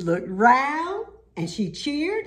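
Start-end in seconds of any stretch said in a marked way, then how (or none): none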